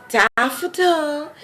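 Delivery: a light take on the husband's teasing voice. Retold or imitated. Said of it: "and he's like".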